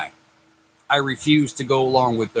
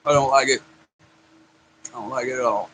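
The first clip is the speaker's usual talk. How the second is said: grimacing over a sour taste; eating sour candy; 4 semitones lower, faster than usual. Real, not acted. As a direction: talk through a sour mouthful. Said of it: "it's so sour".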